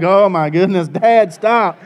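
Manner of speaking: embarrassed daughter. Retold or imitated. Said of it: "And Sadie's like".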